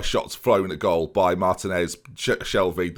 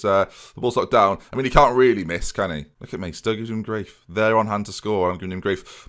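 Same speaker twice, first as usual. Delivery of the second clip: ordinary talk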